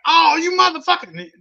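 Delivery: high, loud mock scream of rage; imitating a screaming man. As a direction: yell it like the furious neighbor. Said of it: "This dude starts screaming".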